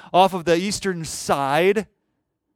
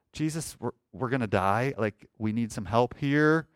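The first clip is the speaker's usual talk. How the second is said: low, restrained plea, no panic; calm request for help; 6 semitones lower, 5 dB quieter than usual. Acted. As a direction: ask for help matter-of-factly, keeping your head. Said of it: "that's not how they woke him up".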